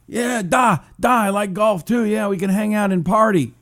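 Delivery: hammed-up Russian accent, Nyet thrown in; bad Putin impression. Host plays Putin answering Trump, mock Russian accent. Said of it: "horrible impression was".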